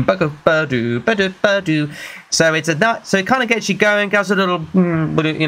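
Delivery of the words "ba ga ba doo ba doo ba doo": mouth-beat ba-ga ba-doom; beatboxed beat. Retold or imitated. Vlogger imitating the song's beat with mouth sounds. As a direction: tap out the drum beat with your voice. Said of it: "So boom".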